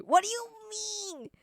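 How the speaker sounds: high, drawn-out squeal of protest; squeaky, affronted objection; indignant protest. Real, not acted. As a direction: stretch it out in high disbelief. Protesting an accusation in chat.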